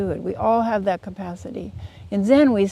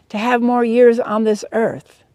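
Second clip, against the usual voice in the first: higher and much louder, earnest cartoon pig; 4 semitones higher, 7 dB louder than usual. Pig insists to the wise ass, higher and loud. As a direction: insist on it, pushing the voice up.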